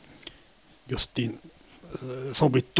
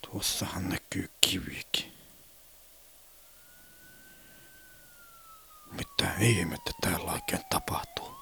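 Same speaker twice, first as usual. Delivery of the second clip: whispered thought; 5 dB quieter than usual. Acted.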